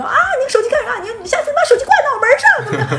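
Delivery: high, loud, fast rant with a laugh; mother berating son in front of coworkers; rapper-like scolding. Retold or imitated. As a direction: rap out the scolding. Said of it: "就跟一个rapper一样在骂我".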